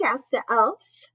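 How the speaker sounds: ordinary delivery, nothing marked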